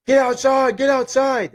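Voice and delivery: high, loud barking order through the door; urgent shout to get out of the room. Retold or imitated. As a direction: yell it like a corporal rousting recruits. Barracks leader yelling through door.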